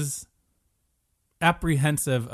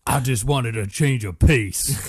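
slow put-on Southern drawl; bayou swamp man; 3 dB louder, slower than usual. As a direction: play a woeful swamp local, drawn out. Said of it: "the guy from the bayou".

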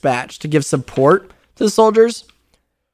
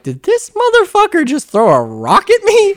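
much higher voiced offended ape, in disbelief; outraged ape. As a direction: play the ape, stunned someone dared to throw a rock. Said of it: "the tech tech just looks back at you and like".

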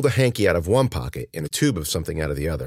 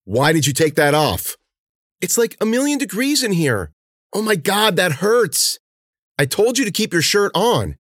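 angry scolding; 12 semitones higher, 3 dB louder than usual. Acted. Cece scolds the stung girl.

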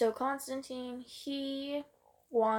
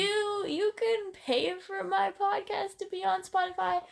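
pitched way up, begging parents to pay; high pleading kid voice; 10 semitones higher than usual. Pretend. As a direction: ask the parents sweetly, a high-pitched plea. Mock asking parents.

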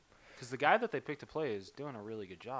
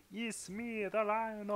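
mock Russian accent, pitched up; Paul Giamatti's Russian accent; 8 semitones higher, slower than usual. To a friend, mocking the Rhino actor: pitched-up Russian accent. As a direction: ham up a villain's Russian accent. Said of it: "I can't sound like Paul Giamatti trying to do a, a Russian accent".

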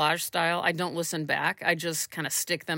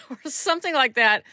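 laughing; 10 semitones higher than usual. Real, not acted. Laughing at the remembered clip.

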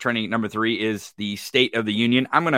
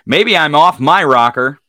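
loud, higher, playful; playful exclamation; 5 semitones higher, 11 dB louder than usual. Acted. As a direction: belt it out playfully. Repeats it loudly, playful.

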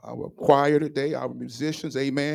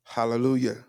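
normal talking voice

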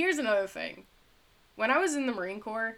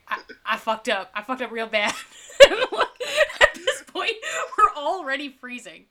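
laughing; 6 semitones higher, 10 dB louder than usual. Real, not acted. Laughing while telling mishap.

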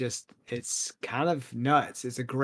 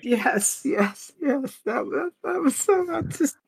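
much higher, louder, almost squealing; excited gushing; 13 semitones higher, 5 dB louder, slower than usual. Real, not acted. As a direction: burst out in delight, voice breaking. Gushing about the Margot Robbie scene.

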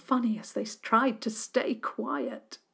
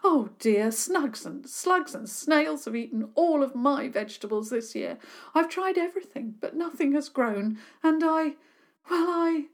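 high, teary and pleading; tearful; 7 semitones higher, 4 dB louder than usual. Acted. Sarah Milburn explaining her failed garden, tearful. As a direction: plead through tears, rising. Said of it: "burst into tears".

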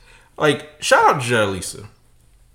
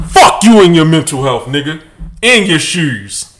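shouted defiance, much louder; 2 semitones higher, 9 dB louder than usual. Shown as a demonstration. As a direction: yell it straight from the chest. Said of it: "Say it for your chest".

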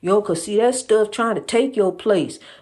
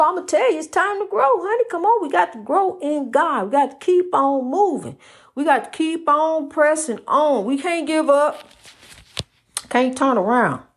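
soaring revival-style encouragement; fired-up preaching; 7 semitones higher than usual. Real, not acted. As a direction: pitch leaps high as the preaching builds. Preaching, urging listeners to grow.

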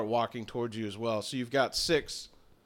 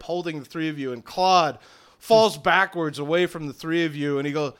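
ordinary talk

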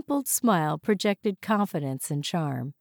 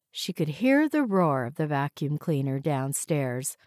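usual speaking voice

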